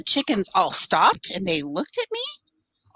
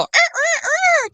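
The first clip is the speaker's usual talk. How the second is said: imitating mom's high strained rooster crow; mock rooster crow; 4 dB louder than usual. Mom demonstrating her crow to her chickens, high squawking crow. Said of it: "I get out there and I go".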